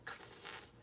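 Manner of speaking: X whispered as a quiet ks; x sound. Shown as a demonstration. Teacher repeats the letter sound for pupils, whispered ks. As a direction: breathe a soft ks. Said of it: "Hear that?".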